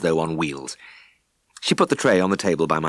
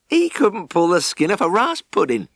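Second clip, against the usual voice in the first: higher, mocking put-down; scornful farmer; 11 semitones higher than usual. Farmer mocking Ned to the vet, scornful and high. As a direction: sneer at the useless farmhand. Farmer mocks his little farmhand.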